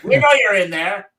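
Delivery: put-on loud, old-time cop calling out; 1930s policeman. 1930s cop calling to a gangster, loud and old-time. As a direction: bark it like a movie cop at a hideout. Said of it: "my nineteen thirties policeman".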